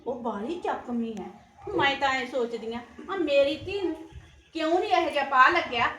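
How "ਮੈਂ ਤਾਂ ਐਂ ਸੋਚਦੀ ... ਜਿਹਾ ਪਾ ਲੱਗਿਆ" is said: higher and louder, fed-up complaint; heated venting. Acted. Complaining to her mother on the phone, heated and loud. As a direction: let the frustration spill out loudly.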